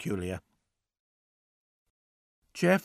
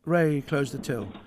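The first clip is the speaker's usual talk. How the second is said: ordinary delivery, nothing marked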